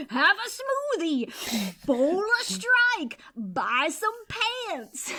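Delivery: high, loud, slow sales pep; pushy cult greeter, sky-high and slow; cheery cult pitch. Retold or imitated. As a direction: push the smoothie with manic cheer. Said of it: "And they're like".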